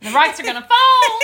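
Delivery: mock panic.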